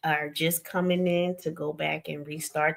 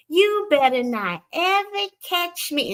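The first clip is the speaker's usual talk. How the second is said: high, slow Southern mama's warning; Southern accent; 11 semitones higher, 4 dB louder, slower than usual. Retold or imitated. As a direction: imitate a mama scolding, drawn-out Southern accent. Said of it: "she said it with a Southern accent".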